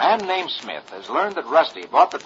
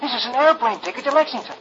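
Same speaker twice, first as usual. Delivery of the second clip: put-on much higher, astonished boyish voice; amazed boy; 10 semitones higher than usual. Rusty finding the plane ticket, amazed and higher. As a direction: gasp it out like a kid who found a clue.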